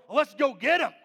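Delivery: high, very loud rallying call; rally the troops for a fight; hammed-up fired-up charge; imagined battle cry. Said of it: "that's how I respond".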